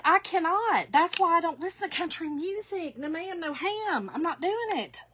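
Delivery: high, mock-indignant protest; emphatic refusal. Retelling her refusal to a coworker, playfully adamant. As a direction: refuse dramatically, loud at first and high throughout.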